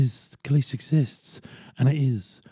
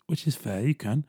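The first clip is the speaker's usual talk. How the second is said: ordinary delivery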